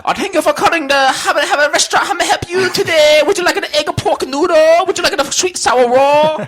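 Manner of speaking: put-on very high, loud singsong Vietnamese accent; mock Vietnamese accent. Host as restaurant phone operator, mock Vietnamese accent. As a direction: take the order in caricatured Vietnamese accent. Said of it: "Mine's too Vietnamese".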